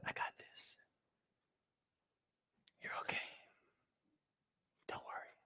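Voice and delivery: soft reassuring whisper; God whispering reassurance to you; gentle whisper. Acted. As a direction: whisper comfort into an ear. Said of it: "God says".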